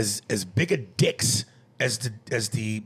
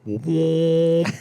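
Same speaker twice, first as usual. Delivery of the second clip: loud mock "womp womp"; sad trombone womp; 5 semitones higher, 9 dB louder than usual. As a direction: sing the failure sound.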